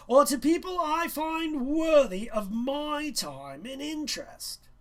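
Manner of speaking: high, loud and haughty schoolmaster; condescending teacher lecturing a student; pompous teacher. A narrator's reading. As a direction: talk down to the boy as a smug teacher. Teacher voiced in story.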